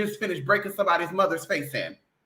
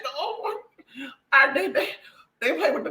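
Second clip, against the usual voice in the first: laughing; 5 semitones higher than usual. Real, not acted.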